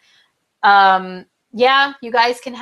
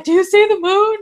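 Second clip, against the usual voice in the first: much higher, breathless mock drama; friend's emotional text; 9 semitones higher than usual. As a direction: gush like a friend overwhelmed by the moon. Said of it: "and be like".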